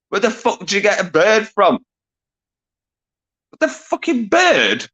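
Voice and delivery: high, disbelieving cry at a silly answer; incredulous outburst. Real, not acted. Quiz host shocked at a viewer's answer, incredulous high outburst. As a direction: pitch shoots up in shock at the answer.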